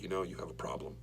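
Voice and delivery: hushed fatherly whisper of concern. Retold or imitated. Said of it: "He's like".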